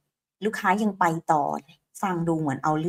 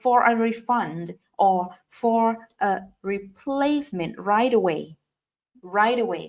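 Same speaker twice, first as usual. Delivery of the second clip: slow drill; much slower than usual. Shown as a demonstration. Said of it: "ดังนั้นติดต่อให้จะเอา".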